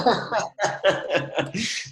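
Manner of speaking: light real laugh. Real, not acted. Laughs at the joke.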